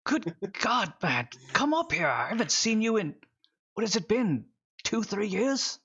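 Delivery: lightly voiced old miner, surprised and glad; a touch of a delighted old man.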